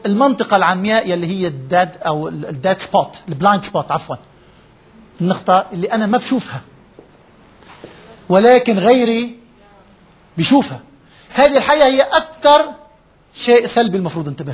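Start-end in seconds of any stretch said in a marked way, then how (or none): none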